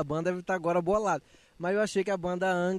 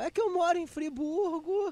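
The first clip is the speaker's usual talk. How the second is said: higher, slower teacher voice; imitating the teacher; 9 semitones higher than usual. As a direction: mimic the late teacher's excuse. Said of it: "ela falava".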